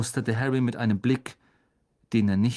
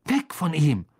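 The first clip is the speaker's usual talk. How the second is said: short, higher, raised command; yelling; 4 semitones higher, 4 dB louder than usual. A narrator's reading. Boy ordering snake off classmate, yelling. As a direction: yell it at the creature. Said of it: "die Schlange dusselig anschrie".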